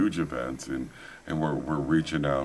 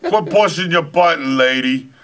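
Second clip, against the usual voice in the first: higher and louder, laughing through a joking yell; laughing mock yell; 13 semitones higher, 9 dB louder than usual. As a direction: shout at the driver while cracking up. Yelling at cab driver, laughing.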